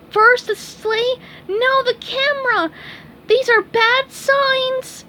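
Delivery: high, loud frightened sponge; scared cry. Acted. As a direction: cry out in alarm.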